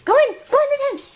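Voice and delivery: high, loud eager command; high excited urging at the dog. Real, not acted.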